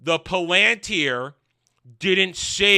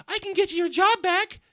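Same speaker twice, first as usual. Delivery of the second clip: much higher sweet pleading voice; mock female friend voice; 13 semitones higher than usual. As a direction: coax him, pitched way up. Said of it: "and says".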